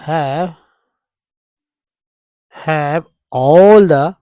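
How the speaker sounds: drawn-out "have all the" spoken as he writes; dictating while writing. Shown as a demonstration. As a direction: say each word slowly while writing it. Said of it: "यहाँ से देखिए लेते हैं". (लिखते हुए धीरे-धीरे बोलना)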